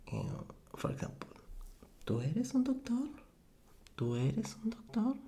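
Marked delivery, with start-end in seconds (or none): 2.1-3.1 "tú eres un doctor" lifted high, as a question; question intonation. Shown as a demonstration. Teacher modelling a Spanish question for learners, question intonation. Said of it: "It's like a question". 4.0-5.1 "Tú eres un doctor" high, rising on "doctor"; question intonation. Shown as a demonstration. Teacher repeating a Spanish example, question intonation. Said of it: "It's like a question".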